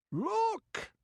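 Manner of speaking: a sharp, very high yelp. Acted. Pirate spots a man on the ramparts, yelping. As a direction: yelp it out over the din. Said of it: "yelped a pirate".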